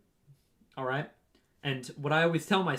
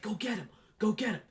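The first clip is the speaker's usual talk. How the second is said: high-pitched encouraging yell; coach yelling; 7 semitones higher than usual. Retold or imitated. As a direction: urge the runner on from the sidelines. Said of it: "my coach even yells at me on the course".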